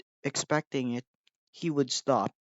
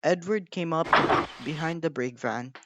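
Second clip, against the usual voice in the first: usual speaking voice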